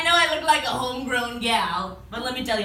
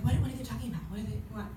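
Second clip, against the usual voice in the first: confused mutter; 9 dB quieter, much faster than usual. Retold or imitated. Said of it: "I'm like".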